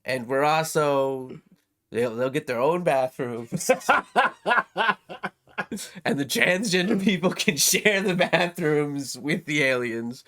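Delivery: Trump impression. Joking podcast host doing a bit of Trump. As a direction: pitch it like a Trump rally brag, only a touch. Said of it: "my Trump is terrible".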